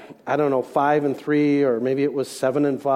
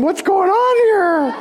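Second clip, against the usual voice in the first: put-on much higher, louder wail of a small boy; crying child; 5 dB louder, slower than usual. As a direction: imitate a scared little boy in tears. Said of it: "Chad started crying, going".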